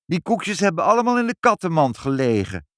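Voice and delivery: put-on high-pitched little girl; sudden blurting child. A narrator's reading. Child to the visitor reaching for a cookie, blurting. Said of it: "maar Ibbeltje zei plotseling".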